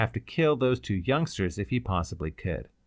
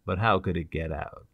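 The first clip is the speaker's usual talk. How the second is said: low-level puzzled question; ask, wanting an explanation; questioning woman; 5 semitones lower than usual. Acted. The girl asks Ryder how it escaped, puzzled.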